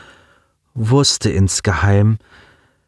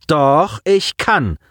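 defiant; 7 semitones higher, much slower than usual. A narrator's reading. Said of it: "reckte ihr Kinn energisch".

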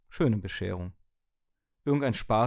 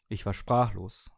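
plain reading voice

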